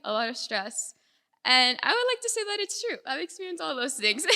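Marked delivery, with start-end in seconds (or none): 1.4-4.2 higher, louder, breaking into a laugh; laughing confession at raised pitch; amused admission. Real, not acted. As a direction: own up to it, tipping into laughter. Admitting hardship with a laugh.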